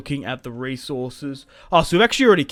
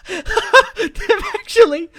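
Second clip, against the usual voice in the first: laughing; 6 dB louder, much slower than usual. Real, not acted. Laughing at allies arriving.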